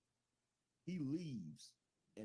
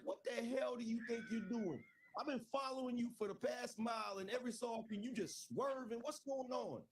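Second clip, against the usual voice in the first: angry cop; 10 semitones higher than usual. Retold or imitated. Cop scolding swerving woman at her window, high stern voice. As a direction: play a scolding highway cop.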